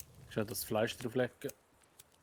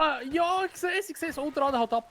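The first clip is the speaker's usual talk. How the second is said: much higher, gleeful excitement; excited squeal; 13 semitones higher, 3 dB louder than usual. Real, not acted. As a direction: cheer it on, pitch way up. Excited reaction to trap kill.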